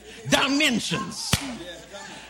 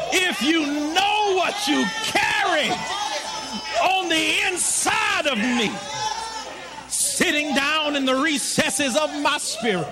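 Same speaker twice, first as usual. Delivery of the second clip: full-force preaching; 4 semitones higher than usual. Acted. Preacher rousing the congregation about inner power, full-force preaching. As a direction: preach it out big to the crowd.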